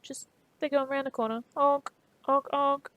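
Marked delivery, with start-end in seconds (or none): 1.6-2.9 mock car horn honks. Imitating car horns in Sydney.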